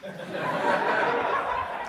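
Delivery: quiet breathy chuckle; a relieved little laugh under the breath; soft laugh. Real, not acted. To the audience after the vortex story, a soft laugh.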